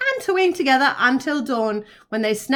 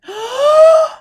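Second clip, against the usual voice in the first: loud, high deep intake of breath; big gasp; 13 semitones higher, 9 dB louder than usual. A narrator's reading. The animals gasp before shouting, big intake. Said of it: "took a huge, deep breath".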